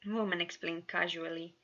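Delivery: plain reading voice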